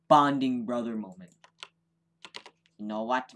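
ordinary talk, nothing marked

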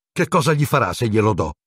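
put-on worried boy asking nervously; very tense. A narrator's reading. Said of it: "chiese Ron tesissimo".

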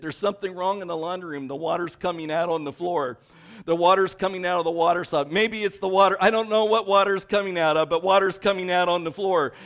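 panicked wife reporting water on the floor; retold urgent voice. Wife calling her husband about the leak, urgent and loud. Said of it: "in an urgent voice".

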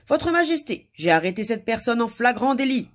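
high, loud formal announcement; reporting guard. A narrator's reading. Guard reports an arrest to the king, loudly formal. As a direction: announce the offence to a monarch.

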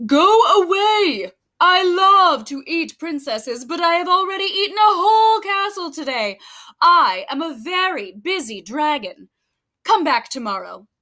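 big booming dragon, bored and busy. Acted. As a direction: play the grumpy dragon brushing her off. Dragon shouts her away.

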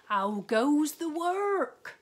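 high, loud, slow bellow from bed; lazy farmer calling. A narrator's reading. Lazy farmer calling from bed to the duck, high loud bellow. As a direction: boom it out like a lazy boss.

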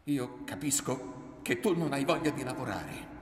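loud, high accusing voice; heated scolding, rising at the end; angry reproach. A narrator's reading. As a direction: build the reproach to a near shout. Mother accuses son in quarrel.

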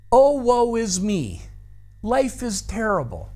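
dramatic sigh. Acted. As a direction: sigh it like a tragic heroine. Mock lament of envious person.

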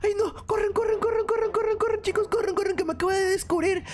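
high, frantic yelling; panicked. Acted. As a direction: flee in mock panic. Caught, fleeing in panic. (pánico fingido)

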